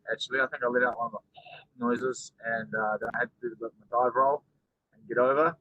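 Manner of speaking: ordinary talk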